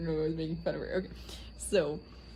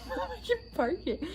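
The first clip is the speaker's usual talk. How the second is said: mock high self. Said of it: "I was like".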